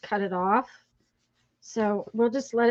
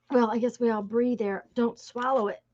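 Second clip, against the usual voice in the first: ordinary delivery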